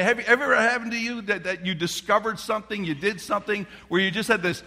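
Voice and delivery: ordinary delivery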